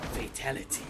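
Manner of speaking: hushed, ominous proclamation; Shang Tsung impression. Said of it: "Shang Tsung goes".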